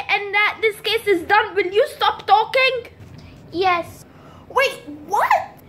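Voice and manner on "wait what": ordinary talk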